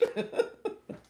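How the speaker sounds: long, high-pitched giggling fit; helpless laughter. Real, not acted. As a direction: crack up at your own blunder. Recalls her own language mistake.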